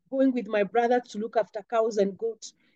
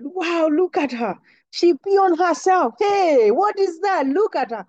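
put-on higher, louder taunting, jeering at the girl; mocking kids; 5 semitones higher, 4 dB louder than usual. Schoolkids jeering at the bleeding girl, taunting and louder. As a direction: sing out the mockery like schoolkids pointing.

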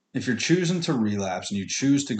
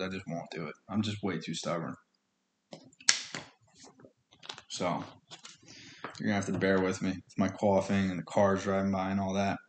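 muttered aside; 2 semitones lower, 7 dB quieter than usual. Real, not acted. Muttered aside after coughing.

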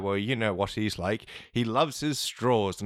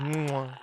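whispered air kiss; 2 semitones higher than usual. Acted. Kiss goodbye to dying wife.

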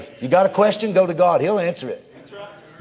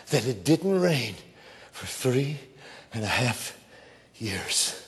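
much lower, softer, slow and deliberate; hushed sermon; 5 semitones lower, 6 dB quieter, slower than usual. Acted. Preacher telling of Elijah's prayer, hushed and slow. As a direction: drop to a near hush for weight.

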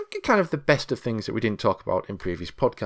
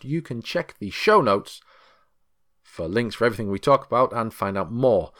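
ordinary delivery

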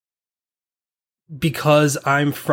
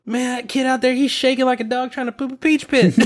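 high, fast Southern holler; Southern folksy talk; 10 semitones higher, faster than usual. Retold or imitated. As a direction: rattle it off like a Southern local. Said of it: "we used to talk".

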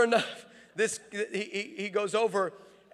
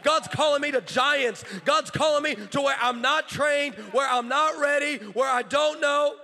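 loud, raised, driving sermon delivery; full-force preaching; 5 semitones higher, 6 dB louder than usual. Real, not acted. Preacher urging people to cross lines, full-force preaching. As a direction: hammer each declaration home to the crowd.

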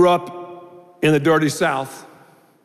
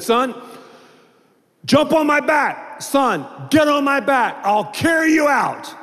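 high urgent shout to son; urgent call; 10 semitones higher than usual. Retold or imitated. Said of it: "He said".

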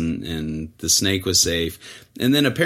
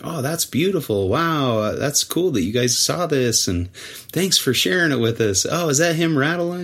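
higher, delighted admiring; impressed; 7 semitones higher than usual. Retold or imitated. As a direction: gush over the snake like a fan. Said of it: "They're like".

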